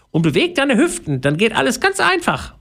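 very high mouse voice, calling encouragement; squeaky calling out. A narrator's reading. Mouse coaching Pauline as she dances, squeaky call. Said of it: "ruft Theodora".

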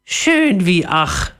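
high, drawn-out dreamy parrot; babbling parrot. A narrator's reading. Sated parrot babbles to itself, high slow voice. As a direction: sigh it slowly in a bird voice. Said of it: "plapperte er vor sich hin".

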